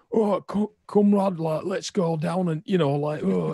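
a northern English worker in Soviet Pripyat; northern English accent. Retold or imitated. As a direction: play a cheery Yorkshire comrade. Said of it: "northern people walking around going like".

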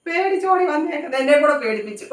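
mother laughs after the cat's fright scared her too; laughing. Real, not acted. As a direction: laugh through the scare from the cat. Startled by the cat, laughing.

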